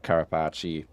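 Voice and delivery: Carapace said as "cara-PAH-chee"; variant pronunciation. Shown as a demonstration. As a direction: end Carapace in a "chee" syllable. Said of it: "I'm not sure how it's pronounced".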